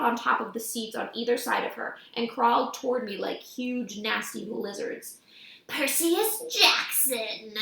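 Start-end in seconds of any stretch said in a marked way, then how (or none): 5.7-7.5 slow, menacing Deep South drawl; deep Southern accent. A narrator's reading. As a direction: lean into a thick Southern accent, slow and threatening. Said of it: "in an accent that was definitely from somewhere farther south".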